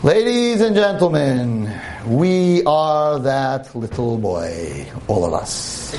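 slow, drawn-out preacher's address; solemn sermon. Acted. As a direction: make the room listen. Preacher turns to moral.

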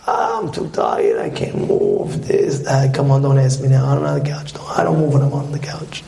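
very low, worn-out groan of a lazy man; too lazy to move, pitch dropped low; tired couch potato. Acted. Couch potato refusing to get up, tired and lazy. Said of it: "the couch potatoes".

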